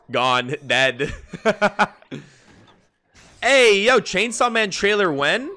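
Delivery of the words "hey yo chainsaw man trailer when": much higher, much louder call; loud high-pitched hype shout. Real, not acted. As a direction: yell it out to chat, hyped. Shouting at chat.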